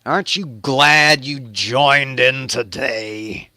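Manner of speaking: slow, drawn-out showman's farewell; mock host sign-off. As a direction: stretch it out like a closing flourish.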